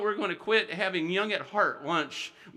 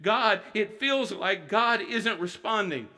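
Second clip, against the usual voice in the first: usual speaking voice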